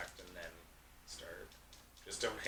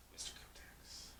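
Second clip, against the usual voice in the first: hushed secret-identity whisper; 11 dB quieter, slower than usual. Pretend. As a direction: breathe it like a secret.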